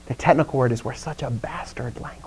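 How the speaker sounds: soft, whispery confession; hushed aside. Real, not acted. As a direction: drop to a near-whisper. Confides a rude word to class.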